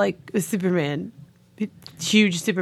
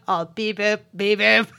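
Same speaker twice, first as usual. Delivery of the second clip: robot voice. Retold or imitated. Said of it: "And he's like".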